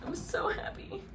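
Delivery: high breathless whisper through tears; tearful whisper. Real, not acted. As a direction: whisper "I'm so happy" while crying. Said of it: "I don't know why I'm crying".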